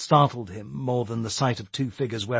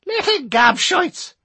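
put-on high, slow scolding old woman; old Irish lady voice; 7 dB louder, slower than usual. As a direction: scold like a cross granny. Old lady Missus Norton.

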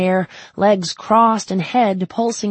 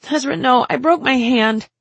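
higher, louder upset voice; distressed Alice on phone; 8 semitones higher, 7 dB louder than usual. Acted. Alice telling Ezra by phone, upset and raised. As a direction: blurt out the bad news, shaken.

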